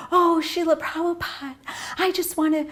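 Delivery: high-pitched, pleading mock woman's voice; put-on high voice, imploring; gushing lady impression. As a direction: mimic an eager lady gushing to her guru. Retold English lady begging for initiation.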